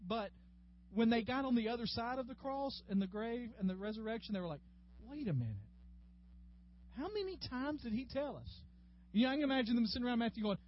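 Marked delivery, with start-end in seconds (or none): 5.1-8.6 disciples puzzling it out, pitch climbing after a pause; dawning realisation. Acted.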